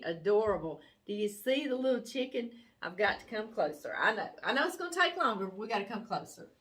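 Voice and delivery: ordinary delivery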